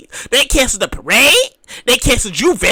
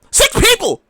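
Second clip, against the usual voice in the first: incredulous; 8 semitones higher, slower than usual. Real, not acted.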